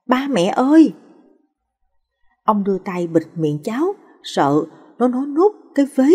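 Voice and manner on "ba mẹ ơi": higher, louder call for his parents; boy's shout. Acted. Boy finds grandpa silent, calls parents in a loud shout. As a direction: shout out for mum and dad in alarm. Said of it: "Thằng bé hét lên".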